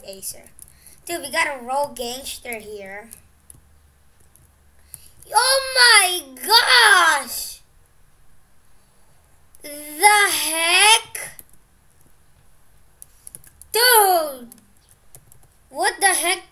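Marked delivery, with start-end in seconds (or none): 5.3-16.4 loud, high, drawn-out shock; real astonishment. Real, not acted. Gamer reacting to a shock in game, astonished. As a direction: cry out in stretched amazement.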